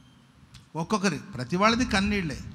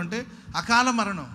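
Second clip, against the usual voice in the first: usual speaking voice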